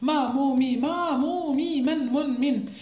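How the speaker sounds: hammed-up halting pupil reading من من من; imitating struggling pupil. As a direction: mimic a child sounding out letters. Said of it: "يقرا بهذه الطريقة".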